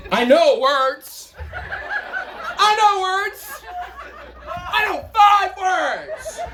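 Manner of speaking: high, slow laughing dopey guy; slow high dim character, cracking up. Acted. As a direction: drag the words out like a proud simpleton. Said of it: "guy with a really, really limited vocabulary".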